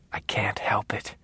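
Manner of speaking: soft breathless whisper. A narrator's reading. Dexter whispering back to his father's ghost. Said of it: "I whispered back".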